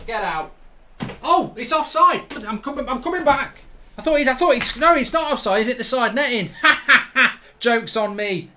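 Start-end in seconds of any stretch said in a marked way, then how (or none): 1.2-6.5 high, loud, surprised relief; excited reprieve. Real, not acted. Realises the goal did not count. 6.5-7.4 loud, high burst of laughter; relieved laugh. Real, not acted. Laughs at the missed goal.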